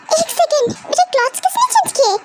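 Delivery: high, fast alarm; sudden surprise. Acted. Daughter notices her changed clothes, high and startled. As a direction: catch yourself in shock.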